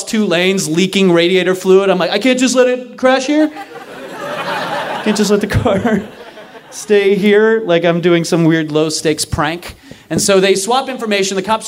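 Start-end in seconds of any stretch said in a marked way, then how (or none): 2.1-9.8 sarcastic protest. Retold or imitated. Sarcastic retort, laughing.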